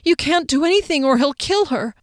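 high, tearful plea through tears; tearful pleading. Acted. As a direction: beg him to stop, choking up. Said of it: "Tears streamed down the pale cheeks".

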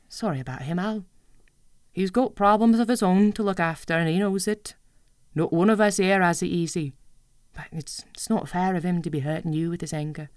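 a lightly voiced mother, kind but firm; Mum's gentle voice. Mother gently telling her child off for father's anger. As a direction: ease the child's hurt, a touch of motherly sternness.

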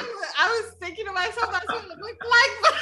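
real high-pitched giggly talk; laughing. Real, not acted. Guest admitting what the donuts looked like, laughing. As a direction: giggle through the words, voice riding high.

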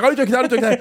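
high mock plea; imitated panicking daughter. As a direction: repeat it in a high kid's voice.

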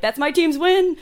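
high, loud mock team pride; mock fan voice. Contrasting fan claims, mock voice.

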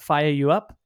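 usual speaking voice

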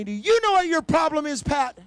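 high, loud preacher's challenge; forceful preaching. Retold or imitated. The Lord confronts Pat on his problem, forceful preaching. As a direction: put the Lord's question to them at full pulpit volume.

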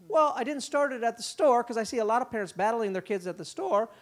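usual speaking voice

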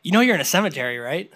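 dry imitation of a sarcastic questioner. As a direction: ask as if stating the obvious. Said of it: "it's like a sarcastic".

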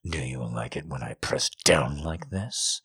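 soft low breathy whisper. A narrator's reading. Lover whispers into her ear, soft low whisper. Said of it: "he whispered".

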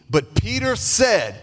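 ordinary delivery

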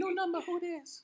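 very high, drawn-out cutesy question; mock girly phone answer, high and slow. Answering an unknown number, in a cute high voice. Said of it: "I'm like".

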